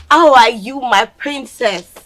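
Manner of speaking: a mother forcing a loud, slow smile through tears; overwrought mother. Acted. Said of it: "Calm down".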